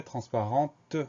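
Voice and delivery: "transparente" with an audible T at the end; sounded final T. Shown as a demonstration. Teacher explaining the feminine singular, final T sounded. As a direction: finish "transparente" on a clearly released T. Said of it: "on va entendre le T muet".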